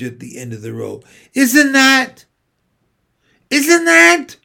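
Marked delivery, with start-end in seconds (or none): 1.3-4.4 put-on high, loud astonished child. A narrator's reading. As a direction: gasp out the question in disbelief.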